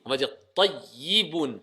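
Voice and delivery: tayyibun with a pressed, doubled yy. Shown as a demonstration. Teacher pressing the shadda to show pupils the correct word. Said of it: "On va appuyer".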